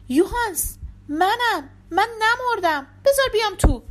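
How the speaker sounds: high, loud appeal to be let in; urgent plea. A narrator's reading. Locked-out mistress to her servant at the door, urgent plea. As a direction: call out desperately to the servant.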